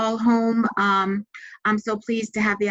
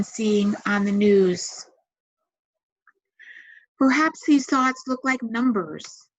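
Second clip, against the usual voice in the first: calm, measured meditation voice with long pauses; guided meditation. Acted. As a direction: guide them gently into relaxation, leaving space to breathe.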